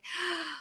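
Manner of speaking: sharp shocked intake of breath, imitating her husband; mock gasp. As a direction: gasp like you just heard a disaster. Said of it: "And he's like".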